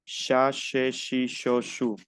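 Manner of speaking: evenly spaced syllables, CH as "sh"; French CH drill. Shown as a demonstration. Teacher drills CH for students to practise, even "sh" syllables. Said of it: "La C y la H es che".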